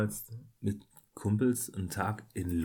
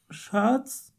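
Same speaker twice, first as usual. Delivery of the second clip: calling out; 13 semitones higher than usual. Retold or imitated. Girlfriend calling from the bathroom.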